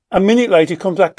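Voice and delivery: ordinary talk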